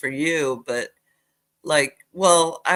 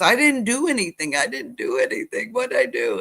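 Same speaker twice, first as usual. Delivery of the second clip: near tears; 8 semitones higher than usual. Retold or imitated. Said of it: "almost in tears".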